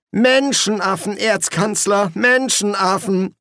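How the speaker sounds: high, robotic monotone; high, automatic drone; mechanical. A narrator's reading. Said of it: "sagte der Quästor mechanisch".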